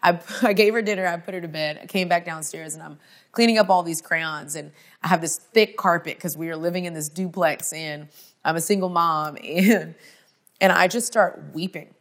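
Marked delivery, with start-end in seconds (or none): none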